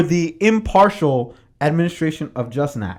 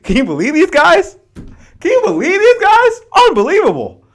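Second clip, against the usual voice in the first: climbing to a much higher, louder disbelieving cry; incredulous outrage; 14 semitones higher, 8 dB louder than usual. Retold or imitated. Boston colonist writing letters about Britain: high incredulous cry. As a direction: shriek it like you can't believe it. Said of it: "be like".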